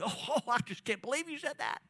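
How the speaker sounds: high, huffy mock offense; hammed-up indignant gasp; mock offended. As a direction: play a listener taking offense. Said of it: "get all offended".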